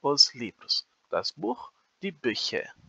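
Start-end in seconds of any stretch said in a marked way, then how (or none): 1.1-2.7 "Buch" with a guttural ch, then "Bücher" with a rounded ü; teacher models "Das Buch, die Bücher" slowly, stressing the umlaut; German pronunciation demo. Shown as a demonstration. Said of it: "Reparem que é uma pronúncia que muda bastante".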